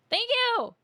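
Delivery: high, loud thank you; excited thanks. Real, not acted. Streamer receiving the notice, loud excited thanks. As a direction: squeal your thanks.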